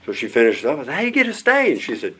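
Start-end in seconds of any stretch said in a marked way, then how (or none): 0.9-1.7 much higher and louder, urgent disbelief; shocked question. Retold or imitated. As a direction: demand to know what happened. Asking a third time.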